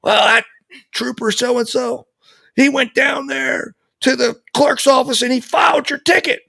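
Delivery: high, near-shouted excited retelling; imitating dad's happy yelling. Father telling his son the trooper filed it, excited shout. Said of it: "he's yelling, but not in a bad way".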